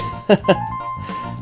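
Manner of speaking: short nervous chuckle; an uneasy little laugh. A narrator's reading. Pony caught hanging out in an alley, nervous laugh. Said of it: "She gave a nervous laugh".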